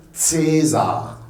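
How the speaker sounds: slow "César" starting with TS; German C as TS. Shown as a demonstration. Teacher spelling the German alphabet, slow TS sound. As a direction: stretch "César" the German way, TSAY-zar. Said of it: "ça se prononce un C".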